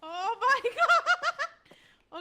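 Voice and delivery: loud slow laughing exclamation; laughing outburst. Real, not acted. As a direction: burst out laughing, high.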